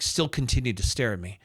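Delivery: ordinary delivery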